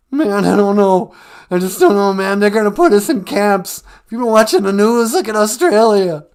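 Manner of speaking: a scared caller losing his nerve; Cowardly Lion freaking out. Acted. Radio caller panicking about the vaccine, loud and high. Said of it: "he sounds totally different today".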